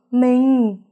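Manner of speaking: a high, loud scream of the husband's name; wife finding her husband dead, screaming in horror; anguished scream. A narrator's reading. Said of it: "mẹ tôi hét lên".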